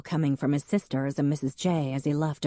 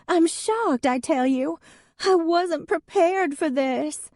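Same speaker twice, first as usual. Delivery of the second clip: high, louder, slow wail; milk the drama; theatrical shock; 13 semitones higher, 4 dB louder than usual. Acted. Hadley to the onlookers, showily shocked and tearful.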